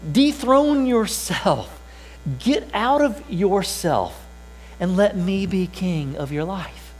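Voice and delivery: high, slow and commanding; voicing Jesus. Pastor speaking as Jesus to each listener, high and slow. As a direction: call the listener off the throne, slowly. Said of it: "he's saying".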